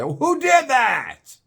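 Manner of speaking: slow, loud, high outraged yell; loud shocked yell. Retold or imitated. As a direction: call out across the room, appalled. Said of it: "We all heard him say".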